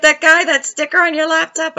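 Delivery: high-pitched, incredulous quote; imitated startled question.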